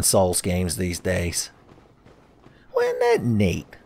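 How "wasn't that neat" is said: put-on high, slow gee-whiz kid voice; wholesome 1950s kid. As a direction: play an innocent old-timey boy. Childlike aside voice.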